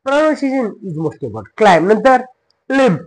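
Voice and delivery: ordinary delivery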